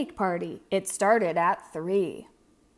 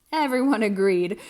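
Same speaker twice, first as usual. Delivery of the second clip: ordinary delivery, nothing marked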